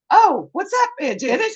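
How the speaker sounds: very high, loud retold hello to an aunt; surprised greeting. Niece discovers her aunt is on the phone, high surprised greeting. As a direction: squeal it as you realise who's calling.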